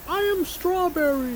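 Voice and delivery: put-on high old lady voice. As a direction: play a little old lady. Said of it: "Why do I sound like an old lady?".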